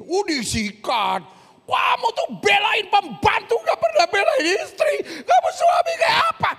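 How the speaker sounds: hammed-up furious wife, high screaming shout; loud, high-pitched acted tirade; enraged wife. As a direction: shriek at your husband in mock outrage. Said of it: "ada orang marah".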